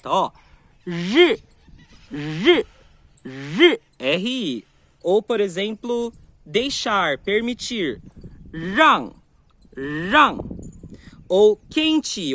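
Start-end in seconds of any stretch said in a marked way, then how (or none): 0.8-3.8 J forte e mais alto, língua dobrada para trás; Mandarin r sound. Shown as a demonstration. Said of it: "você vai tentar falar um som de J". 8.5-10.4 Rang mais alto, com língua bem dobrada; retroflex rang. Shown as a demonstration. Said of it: "você dobra a língua pra trás".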